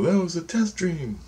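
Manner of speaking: high, lilting voice for dream figures; dream guides' voice. Retold or imitated. Dream figures explaining the test to the dreamer, high put-on voice. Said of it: "they go".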